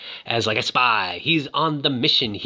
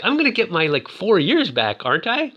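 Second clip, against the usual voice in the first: high-pitched, hammed-up bewilderment; mock confusion; 7 semitones higher than usual. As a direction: retell his panic in a raised pitch. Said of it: "he's all, like, confused".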